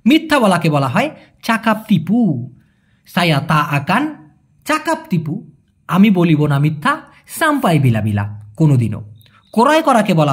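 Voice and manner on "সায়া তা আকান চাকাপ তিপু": normal talking voice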